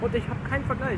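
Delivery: hoch, schnell, mit vollem Mund; „keinen“ mit Essen im Mund gesprochen. Real, not acted. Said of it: "ich muss erst mal spucken". (mit vollem Mund, „keinen Vergleich“ hoch und schnell)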